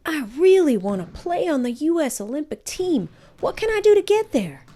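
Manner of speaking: high, eager plea to the coach; eager boy. Acted. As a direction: beg to be shown the way.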